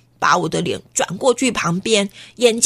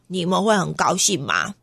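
plain reading voice

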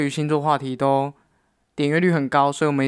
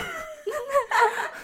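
high squealing laugh; 4 dB quieter than usual. Real, not acted. Laughs at her own mistake.

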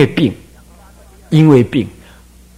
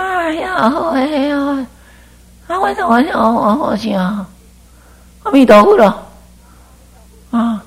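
much higher and softer, a strained wobbly pitch; mock a singer whose pitch slips away; off-key voice; 8 semitones higher, 5 dB quieter than usual. Shown as a demonstration. Monk mimicking someone singing off key, higher and softer. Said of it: "黄音调了".

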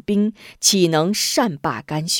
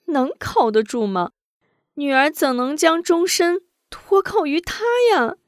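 put-on higher young woman's voice, upset; hurt daughter pleading; tearful daughter; 6 semitones higher than usual. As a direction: protest to father through tears, rising at the end. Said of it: "一边擦眼泪".

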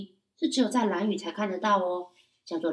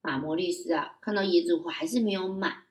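ordinary delivery, nothing marked

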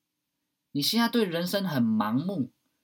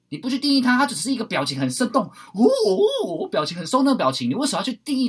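much higher and louder, fired up; excited; 9 semitones higher, 8 dB louder than usual. Real, not acted. Urging the listener not to label anger, excited. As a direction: push it out with rising energy. Hyped explanation.

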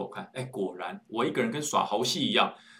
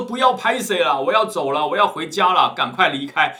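annoyed yell; 4 semitones higher, 7 dB louder than usual. Retold or imitated. Driver yells at stranger.

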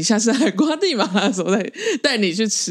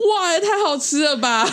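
raised pitch with a laugh; delighted exclamation; 8 semitones higher than usual. Real, not acted. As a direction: gush over the flavor, laughing. 称赞冰淇淋组合. (笑着惊叹，音调上扬)

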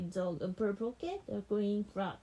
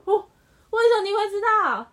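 much louder and higher, faster astonished question; amazed; 8 semitones higher, 12 dB louder, faster than usual. Real, not acted. Child answers correctly.

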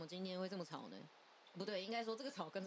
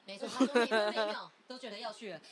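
ordinary talk, nothing marked

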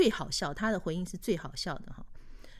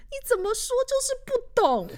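put-on much higher, louder girlfriend voice in exasperation; exasperated girlfriend; 6 dB louder than usual. Girlfriend scolding the boyfriend who never gets it, high whine. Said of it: "然后女生就说".

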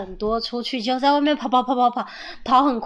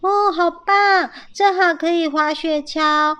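higher, louder cheer; delighted; 7 semitones higher, 5 dB louder than usual. A narrator's reading. Young squirrel welcoming the sledding idea, delighted.

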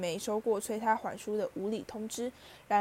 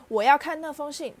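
put-on higher and much louder, a spoiled boy's demand; 6 semitones higher, 8 dB louder than usual. A narrator's reading. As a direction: yell it like a bratty child. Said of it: "他大声说". (被宠坏的孩子大声吵着要看信)

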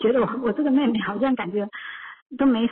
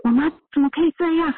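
indignant outburst; 6 semitones higher, faster than usual. Retold or imitated. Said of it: "我就会觉得说".

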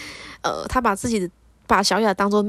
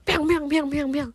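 string of higher-pitched smack sounds; comic sound effect of slapping; imitated slap noises; 5 semitones higher than usual. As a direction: mimic repeated slaps with the voice. Imitates rapid slaps landing.

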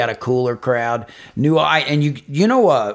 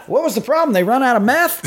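high, mocking question voice; sarcastic high-pitched jab; 9 semitones higher than usual. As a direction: toss it up high like a wiseguy. Mocking question about Shepherdsville.

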